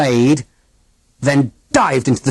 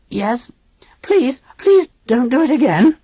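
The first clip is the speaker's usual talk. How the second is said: high begging machine voice; pleading robot aunt; 13 semitones higher than usual. A narrator's reading. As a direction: beg like a scared machine. Grinny pleading.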